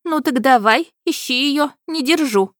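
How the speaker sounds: high, loud dismissive princess; irritated princess. A narrator's reading. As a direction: brush him off like a spoiled royal.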